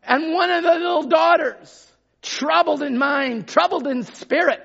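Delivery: high, loud shouted preaching; full-force preaching. Real, not acted. Preacher tells congregation Jesus came with an answer, full-force preaching. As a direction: drive each phrase out at a high-pitched shout.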